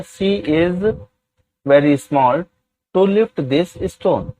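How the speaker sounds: each word spaced out slowly for students to copy; very slow, deliberate classroom dictation. Shown as a demonstration. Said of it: "teacher dictates English sentence".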